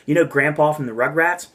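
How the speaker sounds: ordinary delivery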